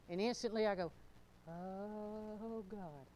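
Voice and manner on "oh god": soft, drawn-out dismayed sigh; retold reluctant groan. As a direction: act out a quiet, sinking groan of reluctance. Retells own reluctant inward reaction.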